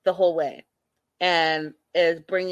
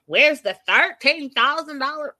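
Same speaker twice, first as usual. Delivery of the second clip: higher, louder mock of a pompous man; 7 semitones higher, 6 dB louder than usual. Host mocking Ed demanding his ring, high and loud. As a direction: parody him demanding the ring back. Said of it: "Who says".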